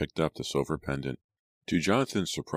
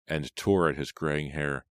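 ordinary delivery

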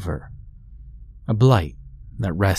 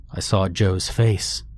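usual speaking voice

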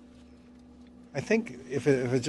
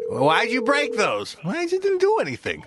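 scolding parent; 9 semitones higher than usual. Retold or imitated. Scolded as a kid, parent voiced.

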